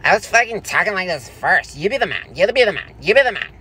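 high, feminine mock-argument voice; petulant, high-pitched feminine protest; effeminate squabble. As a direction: squabble in an exaggerated girly voice. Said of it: "feminine. You're like".

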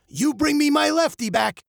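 high call after departing customers. Acted. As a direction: call it across the shop. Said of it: "he called out after them".

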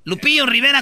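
normal talking voice

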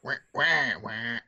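quacking wah wah; duck sound. Pretend. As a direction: honk it like a little duck. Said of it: "Why are you sounding like a duck?".

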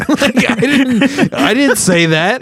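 high, loud indignant protest; laughing, high-pitched disbelieving denial; protesting loudly. Retold or imitated. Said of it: "she just started yelling at me".

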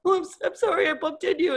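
high mimicked apology; retold apology.

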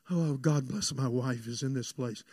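low, soft mock-pious drone; pastoral preacher-wise voice. Husband showing how he doesn't greet his wife, mock pastoral. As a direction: parody a solemn pastor, low and hushed. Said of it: "I don't talk pastoral and preacher-wise like".

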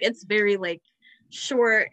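ordinary talk, nothing marked

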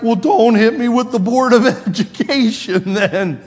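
high, loud, laughing protest; a chuckling schoolboy comeback; laughing kid retort. Retold or imitated. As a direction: crack up while pleading not to be hit. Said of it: "I'm like".

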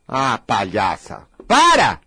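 slow, low put-down after the tantrum; drawn-out scornful aside; mocking dismissal. Real, not acted. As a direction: drop low and draw it out, unimpressed. Drops the mock voice to scold.

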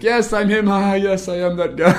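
high, loud, grandly proud I'm-him voice; hammed-up celebrity. Mike embracing fame before fans, hammed-up grand star. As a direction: play the gracious star, a bit overdone. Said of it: "started embracing".

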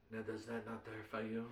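put-on terrible French accent. Storyteller in a French cafe over coffee, bad French accent. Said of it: "That was a terrible French accent".

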